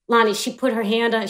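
usual speaking voice

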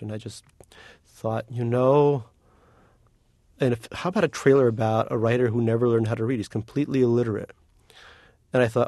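ordinary delivery

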